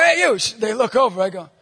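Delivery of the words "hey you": high, loud retold holler; calling out. Calling over to two kids from across the street, shouted. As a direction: holler to get their attention. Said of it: "so I call over".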